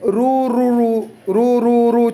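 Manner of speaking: slow drawn-out waves rolling in; teacher imitates waves on the shore; imitating wave sound. Shown as a demonstration. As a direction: let the रू रू रू roll like waves. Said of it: "उसको उन्होंने क्या करें".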